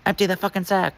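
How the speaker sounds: high, harder mock bark on Empty; angry repeated order. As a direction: lose patience and bark it high. Guard repeats order angrily.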